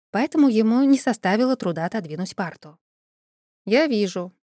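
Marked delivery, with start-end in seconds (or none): 3.6-4.3 deadpan, unmoved reply; emotionless. Acted. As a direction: say it with zero feeling. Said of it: "безэмоционально вымолвил он".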